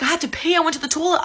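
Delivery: mock defensive brother. Brother explaining himself to his sister, mock defensive.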